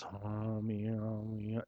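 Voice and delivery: low, soft mock moaning; a hammed-up low whimper of woe; mock lament. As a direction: sigh it out like a sad sack. Mock lament nobody did.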